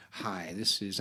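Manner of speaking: low, slow put-on caller voice; prank caller voice. Prankster calling the writer, low put-on voice. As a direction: fake a smooth phone caller. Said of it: "it's this voice going".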